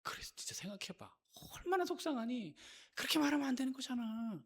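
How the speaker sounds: hushed, insinuating agreement, acted out; egging on. Devil stoking a believer's hurt, softly egging on. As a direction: half-whisper sympathy to feed the grievance. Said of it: "그게 계속 부추기는 거예요".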